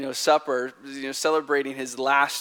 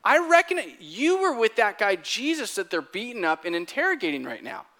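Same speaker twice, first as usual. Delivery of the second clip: high-pitched mock accuser, settling at the end; accusing servant girl; 8 semitones higher, 4 dB louder than usual. Servant girl recognizes Peter's accent, high-pitched accusation. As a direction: call him out as the suspicious girl. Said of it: "she recognizes his accent and says".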